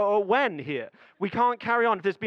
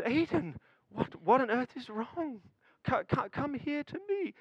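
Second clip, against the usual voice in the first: soft and higher, coaxing; soothing dad; 6 semitones higher, 4 dB quieter than usual. Retold or imitated. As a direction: gently draw the words out of a child. Father soothing crying son.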